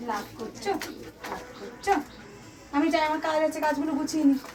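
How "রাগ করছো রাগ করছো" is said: soft coaxing. Real, not acted. Cooing to a pet.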